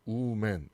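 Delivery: "woman" as OO-men; "woman" with an oo first vowel; mispronounced women. Shown as a demonstration. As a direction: say "woman" starting with a long oo. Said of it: "이런 발음이 아니라".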